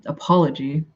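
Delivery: usual speaking voice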